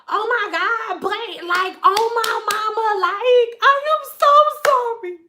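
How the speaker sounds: apologetic client. Retold or imitated.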